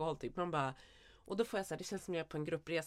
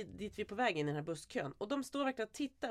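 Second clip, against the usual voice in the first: normal talking voice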